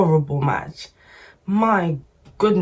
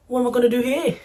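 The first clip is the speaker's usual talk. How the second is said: attempted Manchester accent; 6 semitones higher, much faster than usual. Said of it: "with his accent".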